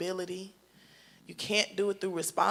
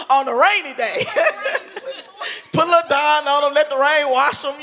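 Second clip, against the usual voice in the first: loud, high, through real laughter; laughing hard; 8 semitones higher, 11 dB louder than usual. Real, not acted. Mom jokes about kids strapped to the roof, laughing. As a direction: crack up while carrying on the joke.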